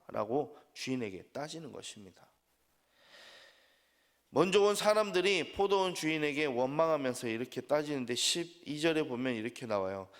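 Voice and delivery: ordinary delivery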